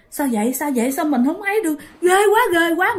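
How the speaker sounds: rising, mildly panicked self-talk; panicked thoughts. Acted. Girl finds she cannot move, acted panic. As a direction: repeat it in mounting fright.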